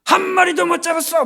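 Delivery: high, irritated yell; peeved long-distance reply; annoyed shout back. Acted. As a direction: shout it back as if bothered by a stranger's question. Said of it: "약간은 귀찮다는 느낌으로".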